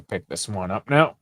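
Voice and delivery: talking between slurps of a drink; slurping a drink. Real, not acted. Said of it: "Sorry, slurping in the mic".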